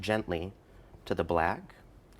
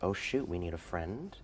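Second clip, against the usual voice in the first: soft, slow, gentle painter voice; hushed and unhurried, like a TV painter; Bob Ross; 4 semitones lower, 7 dB quieter, slower than usual. Retold or imitated. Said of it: "And he goes".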